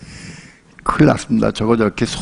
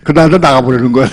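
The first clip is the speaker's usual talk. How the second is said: usual speaking voice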